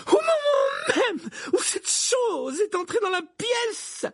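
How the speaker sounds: very high, drawn-out wailing yell; screaming. A narrator's reading. Professor accuses the minister about the creature, screaming. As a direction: wail it slowly at the top of your voice. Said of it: "hurla-t-elle en tremblant".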